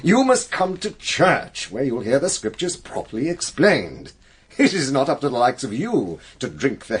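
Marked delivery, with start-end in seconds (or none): 0.0-1.7 slow, higher-pitched, insistent command; stern admonition. Acted. Clergyman tells Nancy off about church, sternly insistent. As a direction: press the order home like a preacher.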